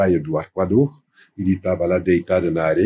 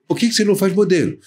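high, quick quoted suggestion; eager suggestion; 9 semitones higher, much faster than usual. Retold or imitated. French friend proposing a modelling career to the narrator, eager suggestion. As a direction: pitch an unexpected idea to a friend, rapidly.

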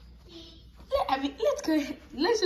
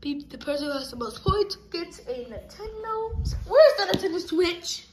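normal talking voice

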